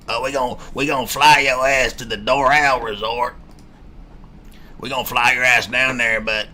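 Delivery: lightly voiced raspy resort staff, mocking; a hint of a chain-smoking waitress pitching a flight; diner waitress cigarette voice. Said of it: "everybody at the Doral Resort talks like that".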